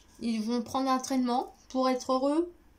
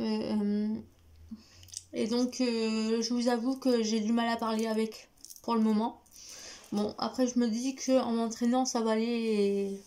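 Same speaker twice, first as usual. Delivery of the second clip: ordinary talk, nothing marked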